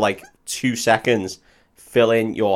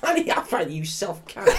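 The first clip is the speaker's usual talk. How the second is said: mock echo; 7 semitones higher, 6 dB quieter than usual. Echoes the line higher.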